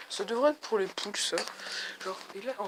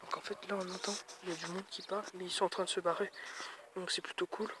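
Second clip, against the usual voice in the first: speaking softly; 8 dB quieter than usual. Real, not acted. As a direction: keep it down, talking softly. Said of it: "je parle tout bas".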